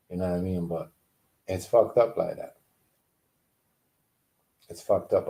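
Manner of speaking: ordinary talk, nothing marked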